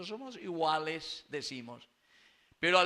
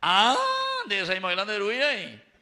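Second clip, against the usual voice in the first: loud exclamation; 6 semitones higher, 11 dB louder, faster than usual. Real, not acted. Preacher exclaims loudly.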